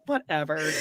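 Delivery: ordinary talk, nothing marked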